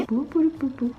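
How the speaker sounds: soft, quick pou pou pou pou; playful pou pou sounds. Acted. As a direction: tap out little pou sounds. Playful sound while applying makeup.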